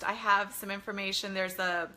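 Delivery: ordinary talk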